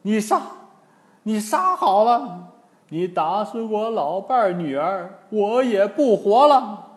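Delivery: put-on defiant old man. Old man defies Wukong.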